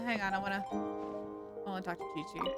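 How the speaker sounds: normal talking voice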